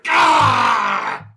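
sharp shouted cry of pain; short stabbed monster yell; pained yelp. Acted. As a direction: yelp as the dagger goes in. Saul stabbed in back.

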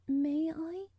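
put-on high, tremulous child's voice. A narrator's reading. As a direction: ask timidly, voice trembling. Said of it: "she said tremulously".

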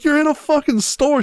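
high-pitched retold protest; mock incredulous scolding. Husband replays telling off wife in store, mock incredulous. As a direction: point out the obvious, high and incredulous.

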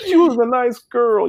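hammed-up tearful protest from the wife; wife defending her tears, mock whiny and high; imitating teary wife. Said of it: "And she's like".

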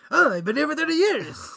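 mock Bernie Sanders voice; Bernie Sanders impression. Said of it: "your Bernie Sanders impression".

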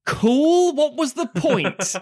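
high, loud mock enthusiasm, slowing down; a mock-enthusiastic cool to Spielberg; sarcastic cool. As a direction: fake delight that sours into a dry question. Mock unimpressed reply to Spielberg.

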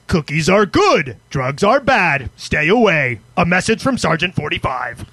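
slow, low sergeant reading out orders. Acted. Co-host reading a sponsor's message aloud, sergeant voice. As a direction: lay down rules like a sergeant, slowly. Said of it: "I like your sergeant voice".

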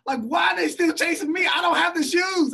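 high, loud, fast, stunned yelling; exasperated, can't believe they're still chasing; baffled disbelief. Retold or imitated. Driver yelling in disbelief at cops chasing his car. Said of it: "And I'm like".

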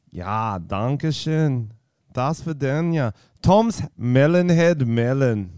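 slow, drawn-out mock Austrian accent; kind-of Austrian accent. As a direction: stretch the words in a hammy Austrian accent. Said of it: "That was kind of Austrian".

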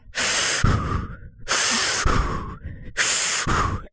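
imitated long, noisy exhales; heavy breathing. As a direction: mimic the roommate's drawn-out puffing breaths. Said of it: "and then I just hear".

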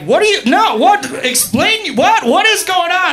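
high, rapid-fire disbelief, voice leaping up; baffled protest. Retold or imitated. Woman to the man in bed, high-pitched bewilderment. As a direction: demand an explanation in mock alarm.

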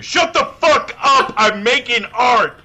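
hammed-up artist outburst; yelling. Imagined outburst at coworkers.

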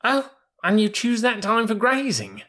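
put-on very high, incredulous young mule; surprised young mule. Young mule questioning the bullocks about grazing, high and incredulous. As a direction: pitch it right up in disbelief.

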